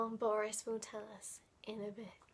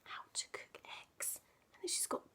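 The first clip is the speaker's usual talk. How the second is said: whispered aside; 13 dB quieter, faster than usual. Real, not acted. Whispered aside reading the picture.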